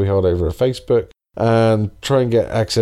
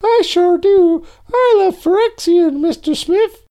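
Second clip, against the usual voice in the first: very high, louder cartoon-kid voice; high silly voice; 4 dB louder than usual. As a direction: squeak it out like a goofy kid. Mimics Phil's silly voice.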